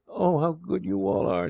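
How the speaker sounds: put-on high, slow, tearful girl's voice; lightly acted sob, drawn out and high; acted tearful sobbing. A narrator's reading. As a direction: overcome with grateful tears, understated. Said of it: "she sobbed".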